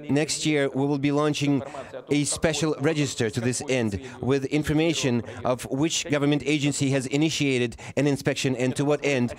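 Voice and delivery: usual speaking voice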